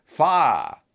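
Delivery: a contained demonstration shout of alarm; mild call-out. Acted. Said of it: "you must call out".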